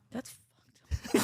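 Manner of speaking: high, laughing yeahs. Real, not acted. Friends laughing at a shocking promo, real laughter. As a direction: laugh through the words. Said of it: "laughing together".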